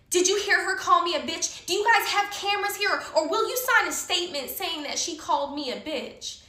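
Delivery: high, loud, outraged tattletale; mock crybaby. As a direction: mimic a whiny baby demanding action. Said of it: "Here goes this little fucking baby".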